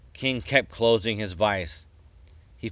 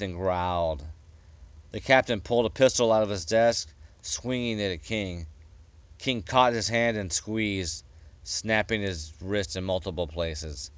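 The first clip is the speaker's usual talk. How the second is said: usual speaking voice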